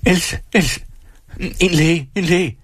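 high, urgent cries for help; aunt's high-pitched panic; panicked calling. Acted. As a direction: call out in alarm for a doctor. Aunt panics, calls for a doctor.

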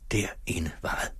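whispered longing. A narrator's reading. Sigvald thinking of the theatre, whispered longing. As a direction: breathe the words, wistful.

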